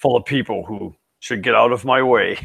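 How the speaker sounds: lightly voiced epic trailer narrator; movie trailer voice. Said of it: "the guy that does the".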